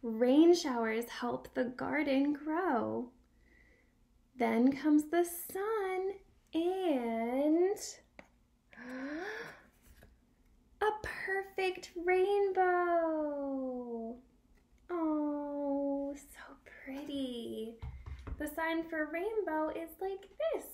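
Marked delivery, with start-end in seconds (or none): 0.0-14.1 slow, bright storybook reading with gasps; reading to a toddler. Acted. Reading picture book to child. 14.9-17.8 awed whisper. Acted. Whispered awe at rainbow.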